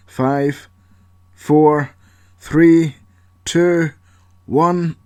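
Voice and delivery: building countdown. Acted. Paul counts down to blowing the door, building excitement.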